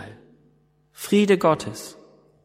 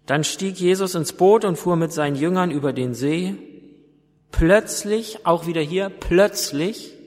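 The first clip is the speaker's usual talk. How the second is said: measured scripture reading to the congregation; Bible reading. Acted. Reads Bible passage aloud.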